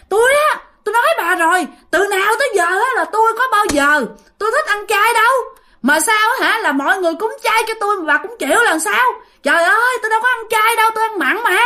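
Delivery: high-pitched, indignant mock tirade; acted angry complaint. Possessed man berates wife over vegetarian food, high indignant complaint. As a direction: berate her in a high protesting voice.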